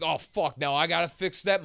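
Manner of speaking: mock annoyance; pissed off. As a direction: grumble it as an annoyed Brooklyn worker. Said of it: "they'd be pissed off".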